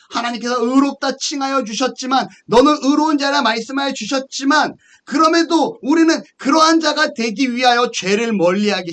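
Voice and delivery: high, insistent preacher's cadence; emphatic preaching. Acted. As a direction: drive each point home from the pulpit. Preaches with force.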